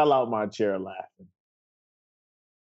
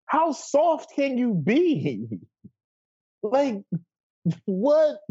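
mocking disbelief; 10 semitones higher, slower than usual. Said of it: "I was like".

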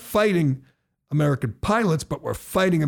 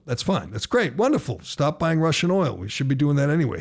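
ordinary delivery

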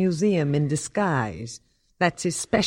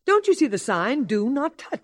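indignant; 7 semitones higher than usual. Acted. Curator scolding visitor.

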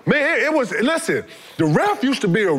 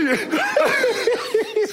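high-pitched cackle; bursting high laugh. Real, not acted. Friend laughing at ref story, high cackle.

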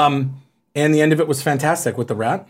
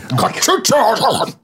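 Sean Connery impression; 5 semitones higher than usual. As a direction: burst out as an angry Connery. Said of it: "Remember when he's like".

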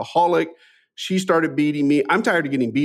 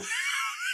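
burst of laughter; 12 dB quieter than usual. Real, not acted. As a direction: break into a real laugh. Laughs at own story.

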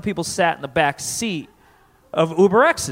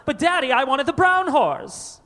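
put-on high, pleading spoiled kid; pampered child, pitched way up; rich kid voice; 9 semitones higher than usual.

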